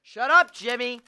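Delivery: sudden loud shout at the pet bird; loud snap. Acted. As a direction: bark it at the squawking bird. George snaps at bird.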